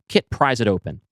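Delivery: usual speaking voice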